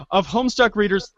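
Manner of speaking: normal talking voice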